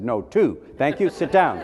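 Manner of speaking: prim teacher shutting down a pupil; curt teacher dismissal. Retold or imitated. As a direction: snap the grade back and dismiss the boy. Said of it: "She said".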